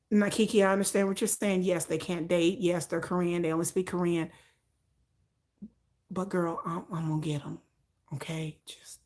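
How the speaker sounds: low, soft and matter-of-fact; quietly certain she'll get him; low steady deadpan insisting; low, calm, determined friend. Retold or imitated.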